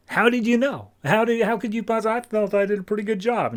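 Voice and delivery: high, fast, caught-out disbelief; stunned disbelief. Acted. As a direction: blurt it out like someone caught red-handed. Villain exposed, disbelieving.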